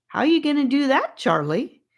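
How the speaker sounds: quick, bright eager asking; cheerful question. A narrator's reading. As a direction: ask with a grin, sure he has a trick. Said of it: "in a cheerful voice".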